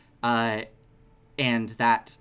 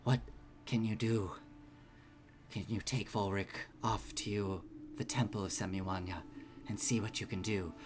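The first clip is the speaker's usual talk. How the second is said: soft, hushed regal request; calming queen; 2 semitones lower, 7 dB quieter than usual. Acted. Game master voices queen calming room.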